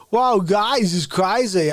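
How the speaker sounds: high, loud mock Christopher Walken; a quick jokey Walken; Walken impression. Mocking Walken to show the wrong way. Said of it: "do Walken and be like".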